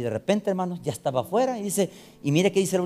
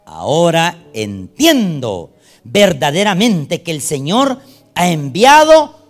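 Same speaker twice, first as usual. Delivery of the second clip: slow, loud proclaiming of the verse; slow, loud reading of the verse; solemn scripture reading; 9 dB louder, much slower than usual. Acted. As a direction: read it out to the church, slow and weighty.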